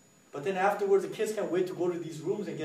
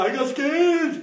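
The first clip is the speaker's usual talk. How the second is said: high, loud, laughing imitation; comic retold fright; mock scared kid; 11 semitones higher, 9 dB louder, slower than usual. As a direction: wail it like a frightened child.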